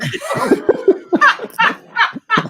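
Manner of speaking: high far laughing fit. Real, not acted. Laughing at Voight fleeing.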